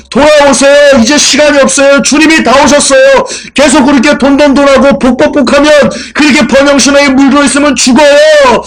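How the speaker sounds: high, loud cry to turn back; urgent shouting warning. Retold or imitated. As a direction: yell it like a desperate street prophet. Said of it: "이때 누군가 외칩니다".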